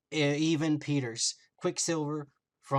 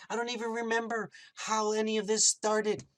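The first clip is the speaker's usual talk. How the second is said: high, helpless-sounding retold plea; playing the victim; 6 semitones higher than usual. Wanda tells Vision she cannot remember, playing the victim. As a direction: imitate someone pleading innocence in a raised pitch. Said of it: "she tries to play the victim".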